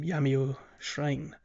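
ordinary talk, nothing marked

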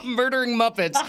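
laughter running through the question; amused laughing. Real, not acted. Amused at the Muppet plan.

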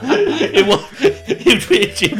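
breaking into high laughter; can't stop laughing mid-sentence. Real, not acted. Host cracks up at own clue.

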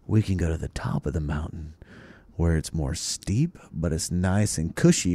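low and soft, a calm big-brother explaining. Retold or imitated. Big brother calmly laying out the options, low and soft. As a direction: talk it down low and gentle like a patient sibling.

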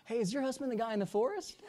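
high put-on voice of a nosy visitor; curious stranger. Clinic visitor asking the wife about her husband, curious.

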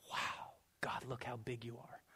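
breathless hushed whisper of awe; awed whisper. Retold or imitated. Preacher miming awe at a beach in San Diego, whispered. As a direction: whisper it like you're staring at something huge.

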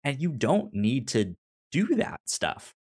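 normal talking voice

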